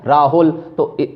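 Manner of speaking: ordinary delivery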